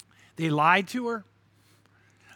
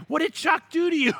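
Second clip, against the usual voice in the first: high-pitched mock concern, What did Chuck do; mock worried churchgoers; 8 semitones higher than usual. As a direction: play the suspicious church folk, pitched up and teasing. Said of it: "they were going".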